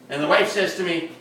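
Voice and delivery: normal talking voice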